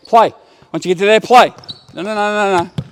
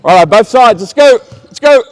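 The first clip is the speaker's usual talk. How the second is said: loud, much higher rally call; energetic shout; 5 semitones higher, 7 dB louder than usual. Real, not acted. Coach sending both sides into the drill, loud rallying shout. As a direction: fire the whole gym up.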